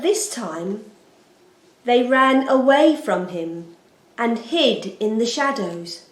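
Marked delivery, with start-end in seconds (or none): none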